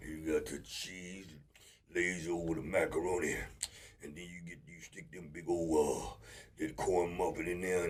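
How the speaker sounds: lightly voiced buddy. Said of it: "I had a big old fat buddy".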